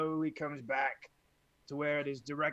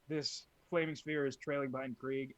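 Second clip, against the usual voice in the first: ordinary talk, nothing marked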